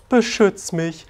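playful helpless plea. Retold or imitated. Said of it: "ein bisschen spielerisch".

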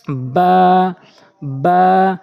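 "বা" drawn out level from closed lips; lips-together articulation. Shown as a demonstration. Teacher shows learners a letter, long "বা" from the lips. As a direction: press the wet lips, release a long "বা". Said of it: "দুই ঠোঁটের ভেজা অংশ মিলাবেন".

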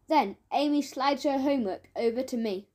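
ordinary delivery, nothing marked

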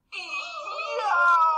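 very high, loud yelp of a scalded wolf; pained scream. A narrator's reading. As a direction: shriek long and high in pain. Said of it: "leapt up with a scream".

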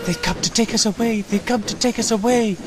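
high-pitched nervous chant; fretful high little voice. A narrator's reading. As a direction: puff it out anxiously, pitched high. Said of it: "Peter Sam puffed nervously".

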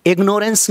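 इग्नोरेंस said as "ig-NORE-ence"; long o in इग्नोरेंस; mispronounced ignorance. Shown as a demonstration. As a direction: say इग्नोरेंस with a full "nore" in the middle. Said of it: "नहीं बोलना".

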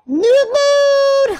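very high, loud, drawn-out cry; shrieked game exclamation; high shout. Real, not acted. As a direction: belt it out way up high.

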